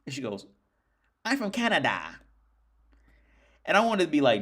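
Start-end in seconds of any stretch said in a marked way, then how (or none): 1.2-2.2 high, mock Canadian "Canada" with a flat a; imitating her Canadian accent, hammed up. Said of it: "you do not say Canada, like Canada".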